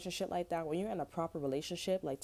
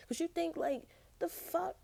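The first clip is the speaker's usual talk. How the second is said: much higher, baffled; exasperated squeak; 13 semitones higher than usual. Real, not acted. Vlogger baffled by a friend's taste, squeaking high. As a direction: let the voice fly up in disbelief. Exasperated rant, pitch soaring.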